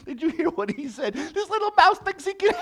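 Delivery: very high, squealing laughter through the words; hysterical laughing. Acted. Lion mocks the little mouse's offer, helpless with laughter. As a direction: crack up until the words squeak.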